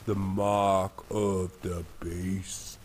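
drawn out, very slow mocking. Shown as a demonstration. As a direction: spell it out slowly, as if to a fool. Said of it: "Maybe if you just talk slow like you're really stupid".

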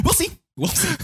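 very high mimicking echo; mock threat. Mimicking co-host's words that sound like a threat. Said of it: "That sounds like a threat".

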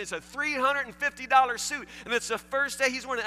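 high, loud indignant scolding; mock scolding wife. The wife joining in against the waitress, shrill mock scolding. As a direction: wag your finger at the waitress. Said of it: "she's wagging her finger, saying".

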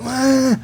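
high, strained wordless cry through a tube; a choked, desperate "ah" from a man who can't talk; strangled cry. Retold or imitated. Patient with a breathing tube trying to cry out, strained high cry. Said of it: "He goes".

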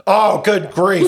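a groan that turns into a laugh; laughing exasperation. Real, not acted. Remembering the forgotten delivery.

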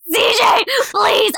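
high-pitched, acted-out pleading; retold sobbing plea. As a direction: plead as if sobbing, without real tears. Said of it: "And I'm, like, sobbing".

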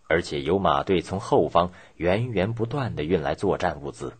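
plain reading voice

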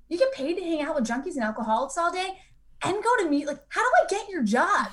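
high-pitched, wide-eyed mock disbelief; retold incredulous amazement. Newcomer marvels at rehab counselor's job, re-enacted high-pitched incredulity.

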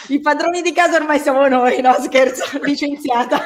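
high, giggly joking; laughing. Real, not acted. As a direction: laugh through the joke. Joking she took over the show.

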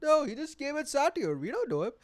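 high, loud panicky denial; embarrassed friends. Pretend. Friends and girlfriend pretending not to know him, panicked. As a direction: disown him in a fluster. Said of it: "my friends and my girlfriend, look".

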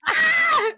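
very high, loud short shriek; hammed-up fright scream; mock scream. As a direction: shriek as if startled by a monster. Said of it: "And you're like".

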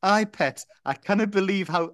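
hammed-up Geordie for Andrew; Geordie accent. Reading Andrew's line in a put-on Geordie accent. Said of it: "I don't know why I'm putting the accent on".

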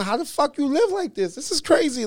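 high-pitched, incredulous question; retold astonishment. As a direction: act out baffled amazement. Retelling his astonished question to celebrities.

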